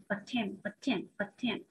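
softly repeated "attempt", stressed on TEMPT; low, soft drill of "attempt", second syllable strongest; light first syllable in "attempt". Shown as a demonstration. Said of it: "vào âm thứ hai".

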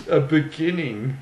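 real laughter interrupting the argument; breaking into laughter. Real, not acted. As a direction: crack up mid-word, then push on. Cracks up mid-argument in retelling.

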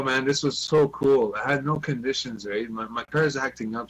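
usual speaking voice

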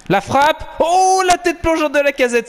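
high, loud play-by-play surge; excited commentary. Real, not acted. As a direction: call the diving header like a commentator. Near goal, commentary burst.

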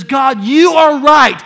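loud, high, slow plea; shouted confession to God; repentant prayer. Acted. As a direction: confess it with everything. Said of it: "someone repents and says".

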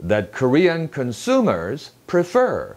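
slow phrase, "consumers" on SU, "prefer" on FER; "That Korean consumers prefer" with marked inner stresses. Shown as a demonstration. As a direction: punch con-SU-mers and pre-FER. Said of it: "수의 강세가 있고 prefer".

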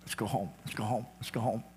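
put-on soft welcome, like a father fetching his child; tender call home. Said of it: "God picking up and saying".

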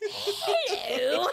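high, loud British hello through laughter; mock British greeting. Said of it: "can't do a British accent".

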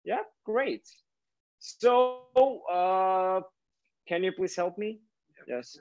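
ordinary talk, nothing marked

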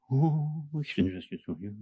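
mocking a limp, timid prayer; a hesitant believer who barely wants to pray; mock timid mumble. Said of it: "Dann brauchst du nicht".

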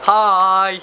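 high, loud, drawn-out call; pretend group greeting. Demonstrates greeting a group of people.

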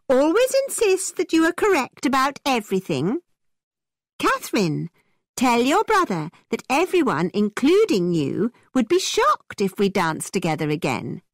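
high, emphatic protest; indignant cry. A narrator's reading. Isabella argues with James through his sister, crying out. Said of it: "cried Isabella".

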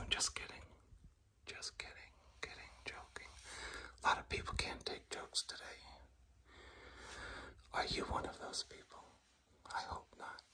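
hushed joking aside; very soft whisper. Real, not acted. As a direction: whisper it to the viewers as a tease.

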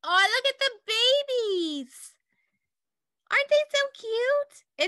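very high, delighted aww voice; cooing over babies. Real, not acted. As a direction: gush over the cute babies. Teacher sees baby penguin photo.

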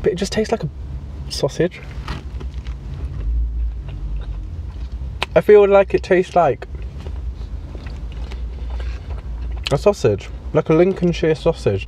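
chewing between words; eating a burger while talking; mouth full. Real, not acted. Seen 0: Eats burger throughout: bites at 14.8, chewing cheeks 16-17.2, burger held.

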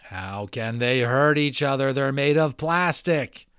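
slow, loud, talking down to a friend. Acted. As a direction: spell it out loud like he's dim. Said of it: "very slowly and loudly".

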